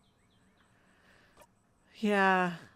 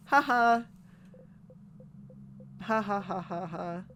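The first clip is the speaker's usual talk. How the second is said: hollow laugh; slower than usual. Acted. Hollow laugh after loop reset.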